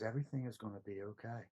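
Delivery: put-on hushed and loving, like a mother soothing a child; loving, calming voice. A woman's voice in the airport calming him, soft and loving. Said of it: "It was very loving, it was very calming".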